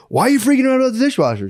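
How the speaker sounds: high, fast exasperated blurt; mock outburst over the dishwasher; exasperated retort. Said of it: "I have no outlet for being like".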